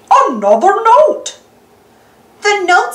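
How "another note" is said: a hint of goofy cartoon voice, higher and a bit louder; just a touch of Goofy spotting something; lightly voiced Goofy. Goofy points out a note, lightly voiced, higher. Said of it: "says Goofy".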